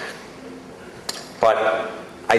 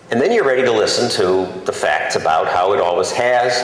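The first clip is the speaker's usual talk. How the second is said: ordinary talk, nothing marked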